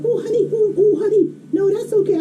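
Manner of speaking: high-pitched, gently reassuring lady; soothing lady. Retold or imitated. Lady excusing the boy who came to apologize, soothing. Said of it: "she's like".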